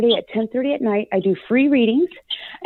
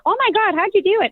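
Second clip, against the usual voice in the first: amazed clients; 10 semitones higher than usual. Retold or imitated. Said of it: "And people are like".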